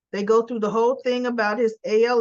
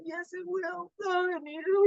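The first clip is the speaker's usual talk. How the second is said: much higher, choked-up son near tears; tearful son; 8 semitones higher, 5 dB quieter than usual. Retold or imitated. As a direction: pitch it up like a son fighting tears. So Finn is crying.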